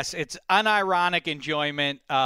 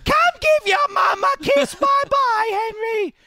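loud, piercing English falsetto; hammed-up English mama voice; mock English accent; 6 dB louder than usual. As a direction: call out in a silly high English accent. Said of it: "English accent from Mrs. Doubtfire".

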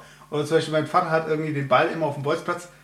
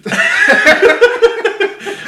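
loud high laugh; burst of laughter; 10 dB louder than usual. Real, not acted. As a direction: crack up loudly. Laughing at bullshit joke.

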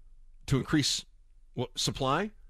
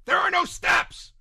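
much higher, louder and slow; exasperated outburst; 5 dB louder, slower than usual. Real, not acted. To viewers, exasperated about the empty promise. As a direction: burst out in disbelief. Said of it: "exasperated outburst".

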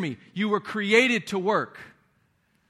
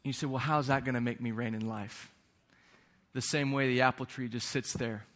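calm, hushed teaching; 7 semitones lower, 6 dB quieter than usual. Acted. Quiet, slow stillness teaching.

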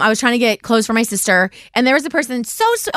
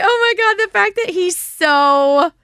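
delighted gush; 7 semitones higher, slower than usual. Retold or imitated. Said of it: "I was like".

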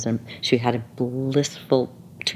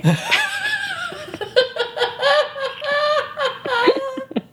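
very high shrieking laugh; shrieking, teary laughter at the friend's question; huge laugh. Real, not acted. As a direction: burst out in a near-crying laugh. Bursting out laughing.

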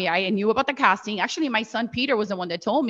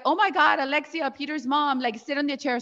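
higher-pitched mock surprise; imitated delighted recognition; 7 semitones higher than usual. Casters spotting Peter's mom, imitated delighted recognition. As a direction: greet a familiar face in a higher voice.

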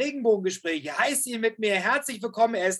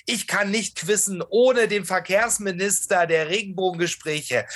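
normal talking voice

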